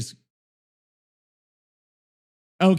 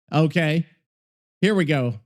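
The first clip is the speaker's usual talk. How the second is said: normal talking voice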